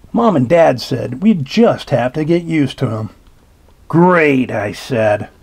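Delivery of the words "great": ordinary delivery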